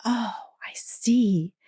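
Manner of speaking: slow, drawn-out realization; dawning realization. Retold or imitated. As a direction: let it slowly sink in. Retold moment of realization.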